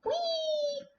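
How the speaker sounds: very high gleeful squeak; squeaky toy voice. Pretend. Squeal while putting pie in oven.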